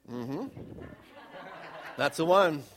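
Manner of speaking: ordinary delivery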